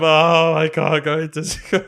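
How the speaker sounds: loud, drawn-out comic wail; hammed-up tearful whine with a laugh; mock crying. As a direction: imitate a wailing child. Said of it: "like, crying".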